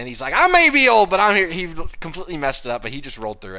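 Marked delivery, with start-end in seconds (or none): 0.3-1.5 much higher, louder wrestler bellow; hammed-up promo shout. Retelling AJ's promo to the co-host, loud and high. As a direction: belt it out like a ring promo.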